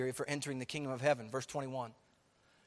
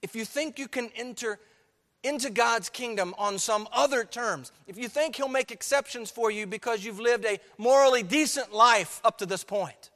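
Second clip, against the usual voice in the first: high, loud pulpit fervor; full-force preaching; 7 semitones higher, 10 dB louder than usual. Acted. Preacher warns the congregation, loud and fervent. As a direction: drive it home to the church.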